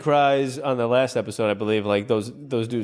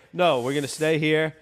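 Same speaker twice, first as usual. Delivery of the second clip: ordinary talk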